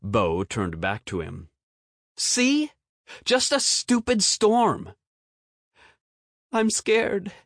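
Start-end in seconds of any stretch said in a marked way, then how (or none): 2.2-4.9 put-on high, scornful kid voice; dismissive boy. A narrator's reading. Bo dismisses the storm. 6.5-7.3 put-on high whimpering child voice; scared child. A narrator's reading. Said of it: "whimpered the boy".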